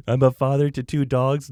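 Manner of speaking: smug proud pet-owner voice; mock doggy daddy. Mocking a doggy daddy, proudly announcing his pets. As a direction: play the self-satisfied dog dad. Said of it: "people that call themselves a doggy daddy".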